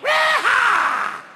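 high whooping shout; rodeo yell. Acted. Drunk coming out of the chute, whooping shout. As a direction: burst out yelling like a bronco rider.

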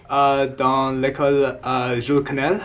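English-speaker accent in French; careful learner French with English accent; foreign accent in French. Real, not acted.

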